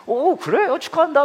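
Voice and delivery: high, bright-eyed "oh really, congrats"; a dad's delighted surprise at his daughter's win; surprised congratulations. Retold or imitated. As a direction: act thrilled at the news. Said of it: "막 그랬어요".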